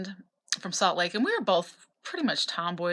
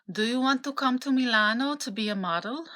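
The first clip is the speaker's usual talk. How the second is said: a weak, mock Italian accent, pitched a bit higher; lightly imitating an Italian scout's accent; bad Italian accent; 5 semitones higher than usual. Italian scout invites a teen model to Milan, in a bad Italian accent. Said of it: "this accent sucked".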